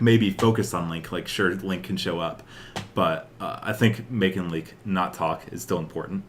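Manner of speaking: ordinary delivery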